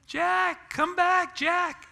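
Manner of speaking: high calling shout for the dog; imitating wife yelling. Imitating wife calling the runaway dog, high loud yell. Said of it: "I hear Joyce yelling".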